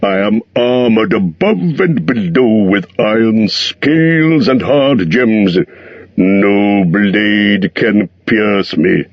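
slow, curt dragon retort; dragon snapping. A narrator's reading. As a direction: cut the hobbit down sharply. Smaug boasts.

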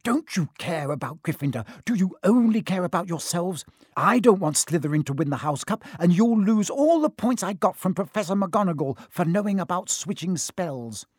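indignant, insistent tirade; angry hissing. A narrator's reading. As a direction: berate them like an angry goose. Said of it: "hissing at them like an angry goose".

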